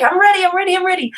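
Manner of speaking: put-on high, rapid-fire kid's chant; eager child. Eight-year-old coming over to be tickled, eagerly excited. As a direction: bubble over like an impatient little boy.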